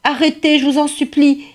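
appel aigu et désespéré, peu plus fort; cri suppliant. Acted. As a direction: supplier en criant vers les chasseurs. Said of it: "pauvre bûcheron hurlait". (le bûcheron supplie les chasseurs, cri aigu)

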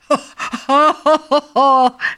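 loud mocking rhino laugh; big hammed-up bully guffaw; villain's laugh. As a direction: laugh down at the small bird. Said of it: "Le rhinocéros se met à rire".

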